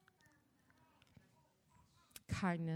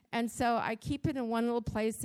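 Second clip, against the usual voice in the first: ordinary delivery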